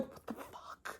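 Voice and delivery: voiceless, disbelieving whisper; stunned whisper. Real, not acted. Seeing smoky quartz on the other side, stunned whisper. Speechless at the stone.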